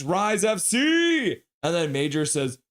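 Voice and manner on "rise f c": drawn-out, high-pitched cheer; rallying cheer. Acted. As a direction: belt it out, stretching the final syllable. Reading a donor's cheer aloud.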